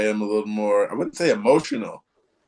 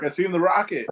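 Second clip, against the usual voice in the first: excited report; 7 semitones higher than usual. Retold or imitated. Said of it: "I told Mark".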